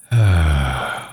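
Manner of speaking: long, falling, resigned sigh; hurt sigh. Acted. Vampire after farmer reacts to confession, hurt sigh. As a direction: sigh at being rejected.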